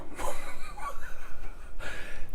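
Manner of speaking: airy, unvoiced laugh; breathy laugh. Real, not acted. Amused by his own joke to the coworker, breathy laugh.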